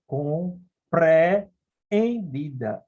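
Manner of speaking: "compreendida" very slow, syllable by syllable, "e-en" split in two; syllables pulled apart. Shown as a demonstration. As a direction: stretch "compreendida" so both vowels sound separate. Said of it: "Olha as duas vogais em sílabas separadas". ("compreendida" bem devagar, separando as sílabas)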